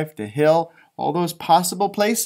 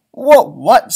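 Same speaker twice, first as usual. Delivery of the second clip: higher and louder, alarmed; startled question; 6 semitones higher, 11 dB louder than usual. Acted. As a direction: blurt it out in sudden alarm. Character alarmed in read-aloud story.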